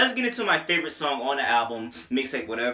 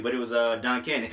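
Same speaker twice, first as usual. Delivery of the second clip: ordinary talk, nothing marked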